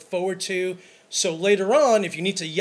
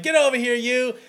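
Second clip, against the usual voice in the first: playful greeting; 7 semitones higher, 6 dB louder than usual. Retold or imitated. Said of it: "I'm like".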